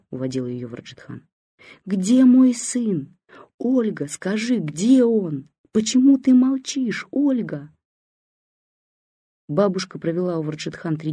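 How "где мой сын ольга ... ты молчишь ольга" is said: высоко, громко, медленно; кричи, как человек, не слышащий себя; крик глухого. A narrator's reading. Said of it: "Так кричат люди, потерявшие слух". (бабушка требует ответа у дочери: протяжный крик)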